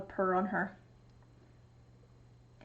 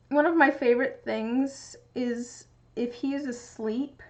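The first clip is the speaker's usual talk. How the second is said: ordinary talk, nothing marked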